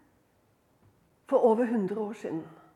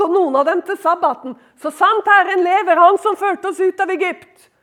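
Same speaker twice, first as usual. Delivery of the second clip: loud, raised declamation; fervent preaching; 8 semitones higher, 10 dB louder than usual. Acted. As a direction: proclaim it with mounting force to the congregation. Preaches at full force.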